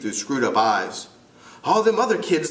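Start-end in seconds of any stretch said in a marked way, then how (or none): 1.6-2.5 high British schoolboy voice; amateur British accent, pitched up. Acted. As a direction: play a piping British boy. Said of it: "try to do it with a British accent".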